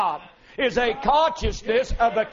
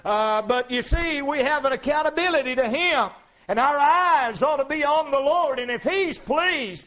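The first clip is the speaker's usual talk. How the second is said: ordinary talk, nothing marked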